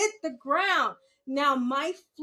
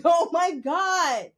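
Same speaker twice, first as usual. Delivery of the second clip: louder, slow exclamation; mock shout; 3 semitones higher, 7 dB louder, slower than usual. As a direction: cry it out in disbelief. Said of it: "I was like".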